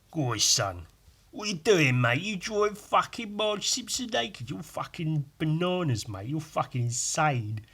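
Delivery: rough London geezer voice, mocking; Geezer Ewan. Mate mocking him for drawing cartoons, geezer voice. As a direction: laddish bloke ribbing his mate.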